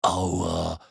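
low, drawn-out moan; pained groan. A narrator's reading. Tete after tumbling down, groaning. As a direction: groan from an aching back. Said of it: "stöhnte Tete".

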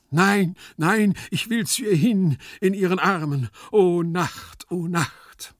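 loud, high, impassioned cries; melodramatic, high-pitched outburst; frantic passion. Acted. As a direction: cry out like a desperate lover. Frantic lover refuses to calm down.

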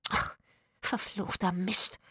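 slow, sibilant swearing; hissed curse. A narrator's reading. Woman who knocked the lantern over, hissing. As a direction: hiss it through clenched teeth. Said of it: "zischte sie".

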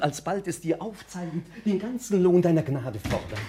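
high, ringing poetic rapture; ecstatic declamation. Acted. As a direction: soar like a poet carried away. Poet declaims his rapture.

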